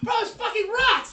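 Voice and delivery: very high, loud metalhead shout; over-the-top excited yelling; hyped-up yell. Acted. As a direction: shout it, totally pumped. Voices pumped-up character in game.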